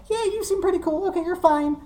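high-pitched goblin calling out; squeaky goblin shout, casually threatening; goblins yelling out. Acted. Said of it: "a couple of the goblins yell out".

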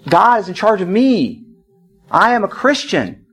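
slow, measured, sincere declaration; calm assurance. Retold or imitated. Wife answers husband without fighting, calm slow assurance. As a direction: let each statement land gently, with pauses.

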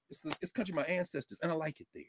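put-on soft, hushed old jazzman voice; [name]. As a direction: say it quietly, like the old man himself. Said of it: "Pop said".

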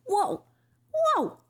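high drawn-out cartoon whoa; Crash impression. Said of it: "that was a good Crash impression".

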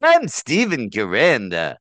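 loud, swooping announcer-style sign-off; Stephen Caranda impression. Shown as a demonstration. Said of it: "a really good Stephen Caranda impression".

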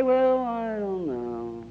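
slow, drawn-out reluctant grumble; long, sliding, unconvinced drawl; doubtful skeptic. Acted. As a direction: voice a dubious churchgoer, dragging every word. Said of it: "some skeptics say".

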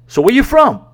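high, loud imitation of a prying stranger; mock nosy stranger. Nosy stranger's sudden question.

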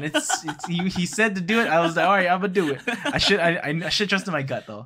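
laughing. Real, not acted. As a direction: talk while laughing at yourself. Laughing about the embarrassing take.

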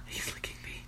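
whispered aside about the cat; breathy murmur to herself; hushed aside. Real, not acted.

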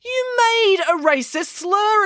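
high, slow indignant headmaster; accusing headmaster. A narrator's reading. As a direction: accuse him, drawing it out. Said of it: "accused the headmaster".

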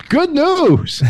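high, sing-song mock customer-service cheer; chirpy agent. Mimicking the chat agent's cheery offer, high sing-song mock cheer. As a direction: chirp it like an overeager rep.

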